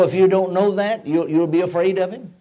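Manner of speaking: usual speaking voice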